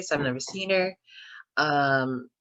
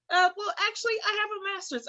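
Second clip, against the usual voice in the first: sassy; 9 semitones higher, 3 dB quieter, faster than usual. Retold or imitated. Sassy retort, high pitched.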